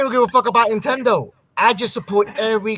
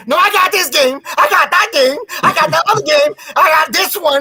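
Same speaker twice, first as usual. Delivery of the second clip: higher, eager boasting about games; excited bragging; 6 semitones higher than usual. Retold or imitated. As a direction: show off the whole collection. Pirate bragging about owned games.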